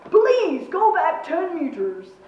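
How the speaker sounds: commander's forceful, drawn-out appeal; order turned request. A narrator's reading. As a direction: turn the command into a slow request. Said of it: "the order turned into a request".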